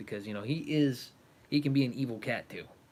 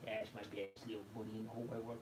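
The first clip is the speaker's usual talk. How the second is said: soft cooing to the cat; 16 dB quieter than usual. Real, not acted. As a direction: coo it gently into the fur. Cuddling the cat.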